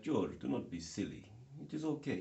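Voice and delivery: put-on Russian accent, lifting at the end; playful fake Russian accent. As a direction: reassure in a mock Russian accent. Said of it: "I was doing this accent".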